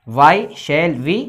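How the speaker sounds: every word drawn out slowly for writing down; very slow dictation. Shown as a demonstration. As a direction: read it very slowly so students can copy. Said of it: "dictating the sentence start". (बहुत धीरे-धीरे लिखवाते हुए)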